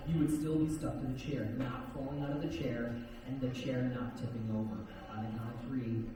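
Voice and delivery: low, soft, steady hypnotist voice; hypnotic suggestion. Acted. Hypnotist giving suggestions on stage.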